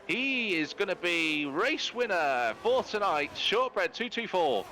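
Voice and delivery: high-pitched, excited motorsport commentary; live finish-line play-by-play. Real, not acted. Commentator announces the race winner and podium, excited play-by-play. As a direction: call the finishers home, pitch riding high.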